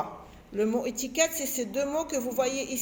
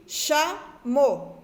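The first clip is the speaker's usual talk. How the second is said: "chameau" slowly split into two syllables; 2 semitones higher, 3 dB louder than usual. Shown as a demonstration. Teacher showing children the syllables, "chameau" slowly separated. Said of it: "La première syllabe".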